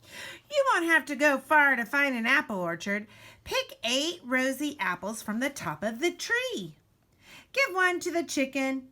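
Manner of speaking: ordinary delivery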